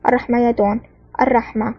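usual speaking voice

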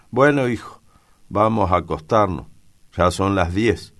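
high, loud and jolly; cheerful exclamation. A narrator's reading. Father sending his son off to bed, cheerful. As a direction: call it out cheerily. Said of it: "exclama alegremente".

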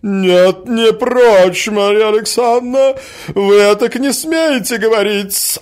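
high, loud offended woman; indignant tirade. A narrator's reading. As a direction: hammer out the grievance at full voice. Quarrelling woman refuses to back down. (скандальная, громкая возмущённая тирада)

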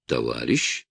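a quiet, suspicious question. A narrator's reading. Hospital nurse questions a visitor, quietly suspicious. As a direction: doubt every word he says. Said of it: "Подозрительно сказала женщина".

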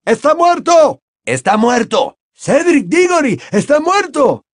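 very high, loud screams of horror; panicked crowd screaming; shrieked outcry. A narrator's reading. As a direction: shriek the news into the night. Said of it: "otros las gritaron, las chillaron en la noche".

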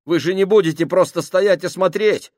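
nervous plea building to a frantic, high, loud cry; panicked pleading. Acted. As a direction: start uneasy, end in near-hysterical panic. Panicking sailor pleads with crew.

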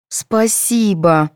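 very slow reluctant thanks; glum. A narrator's reading. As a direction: drag it out unwillingly. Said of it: "уныло повторили недовольные девицы".

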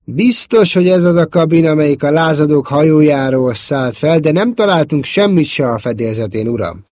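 loud, high military report; calling out. A narrator's reading. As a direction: shout the report across to your officer. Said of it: "oda kiáltott egy közelben álló tisztnek". (katonásan odakiáltva a tisztnek)

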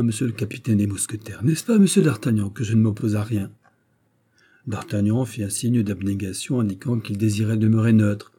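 usual speaking voice

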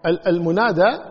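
ordinary talk

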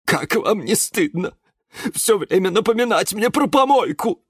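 high, wounded reproach; high-pitched quavering hurt; about to sob. A narrator's reading. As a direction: protest on the verge of tears. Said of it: "была готова разрыдаться".

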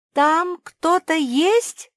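high, loud, drawn-out call. A narrator's reading. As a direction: shout slowly into a crack. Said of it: "и крикнула". (протяжный крик)

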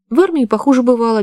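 cheerfully unbothered; carefree. A narrator's reading. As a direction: brush it off lightly, voice lifted. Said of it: "беспечно прокомментировал Полуянов".

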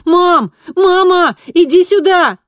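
loud, high, urgent call; shouting. A narrator's reading. Kristina calling her mother from the threshold, urgent shout. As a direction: yell for Mom to come at once. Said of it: "закричала".

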